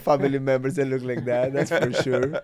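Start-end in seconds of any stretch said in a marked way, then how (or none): none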